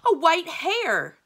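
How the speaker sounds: very high, loud, drawn-out yell; horrified shriek. A narrator's reading. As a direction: shriek it out in theatrical horror. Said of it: "interrupted by a terrible shriek from Araman".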